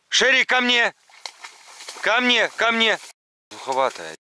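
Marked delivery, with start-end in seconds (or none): 0.1-3.0 high loud call to the dog. Real, not acted. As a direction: summon her from far off. Calling dog back.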